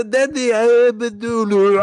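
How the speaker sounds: high, strained mock accent on "Daddy"; terrible fake accent. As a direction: imitate a bad actor's botched Russian accent, stammering. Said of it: "His accent is fucking terrible".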